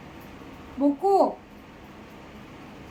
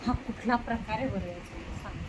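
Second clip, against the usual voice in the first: low, much softer wondering aloud; soft puzzled muse; 6 semitones lower, 9 dB quieter than usual. Real, not acted. Mother puzzling over the dog's pose, soft low mutter. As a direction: mutter it half to yourself.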